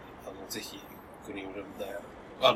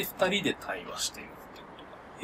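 whisper; 4 semitones lower, 5 dB quieter than usual. Real, not acted. Explaining the guest format, whispered.

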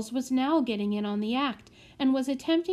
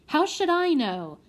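snapped; 6 semitones higher, 5 dB louder than usual. Acted. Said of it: "snapped Solly".

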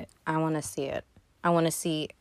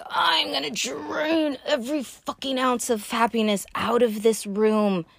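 higher mock-villain shout that slows at the end; energy vampire voice; 5 semitones higher than usual. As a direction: ham up a gleeful energy-sucking villain. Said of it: "She's like".

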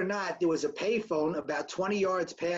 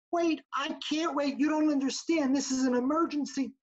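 desperate plea; 7 semitones higher than usual. Retold or imitated. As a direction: beg as if every second counts. Pleading with woman for phone.

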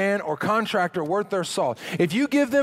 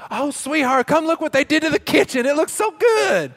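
excited wife; 8 semitones higher, 5 dB louder than usual. Retold or imitated. Wife watching HGTV calls husband over, high excited gush. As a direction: gush like a wife in love with the remodel. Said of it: "most husbands' nightmare".

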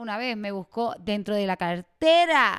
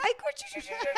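put-on high cutesy baby talk to a grown cousin; mock baby-talk cooing; 5 dB quieter than usual. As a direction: coo at him like a baby. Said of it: "me dan ganas de hacerle".

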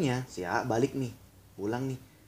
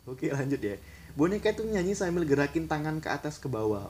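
ordinary talk

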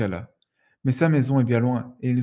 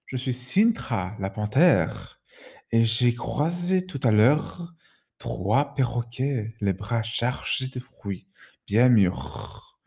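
lazy big cat taking its time; slow purring panther; much slower than usual. Acted. Panther character voice.

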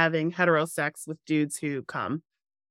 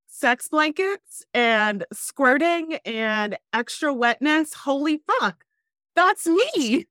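excited; 7 semitones higher, slower than usual. Retold or imitated. Excited shared discovery quote.

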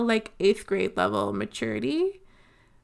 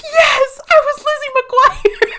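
loud, much higher laughing shout of recognition; excited yes; 13 dB louder, slower than usual. Retold or imitated. Friends answering together after the class, loud laughing delighted shout. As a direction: blurt it out in delighted disbelief, laughing.

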